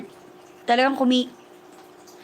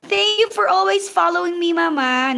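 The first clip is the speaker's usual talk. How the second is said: higher, gushing thank you; excited thanks; 8 semitones higher, 4 dB louder than usual. Real, not acted. Thanking a loyal follower in the chat, high gushing thanks. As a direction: thank her like she made your day.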